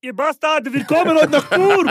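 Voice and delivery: loud, high-pitched hyped-up insult; aggressive story impression. Imitating a friend's social media story, loud and aggressive. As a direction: imitate a comedian yelling an abusive tour promo. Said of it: "Und dann in der Story".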